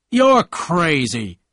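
loud, high and drawn-out accusation; cried out. A narrator's reading. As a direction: exclaim it slowly, in outrage. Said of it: "cried Tom".

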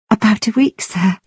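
ordinary delivery

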